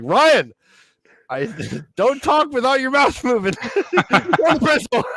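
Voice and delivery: high, loud hammed-up teacher scolding; shrill teacher losing it, played for laughs; mock scolding teacher. Teacher scolding Ryan for talking, mock angry. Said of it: "And she's like".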